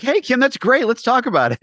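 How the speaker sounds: high, bright fake-cheery voice; hiding annoyance behind forced cheer; fake cheerful. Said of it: "Outside, I'll be like".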